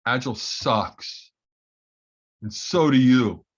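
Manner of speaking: blunt retold insult. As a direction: imitate a colleague's blunt verdict, spacing out each sentence. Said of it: "he goes, just like this".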